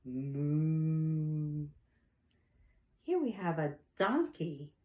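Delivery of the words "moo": long, steady, drawn-out moo; cow moo. Acted. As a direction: imitate a cow with one sustained moo. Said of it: "and a cow says".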